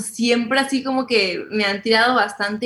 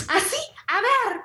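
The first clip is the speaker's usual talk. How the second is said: high, laughing mock threat; laughing grandma imitation; 14 semitones higher, 3 dB quieter than usual. Grandma grabbing her bag at the lady, laughing mock threat. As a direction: laugh through a fierce grandma. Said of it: "Mi abuela agarró la bolsa".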